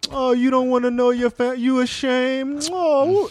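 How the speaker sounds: high, wailing guilt trip with a long drawn ashamed; theatrical Haitian guilt trip. Retold or imitated. Haitian uncle guilt-tripping his nephew in a high wail. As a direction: lay on the guilt like a dramatic uncle. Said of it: "they're so theatrical".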